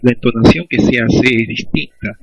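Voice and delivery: ordinary talk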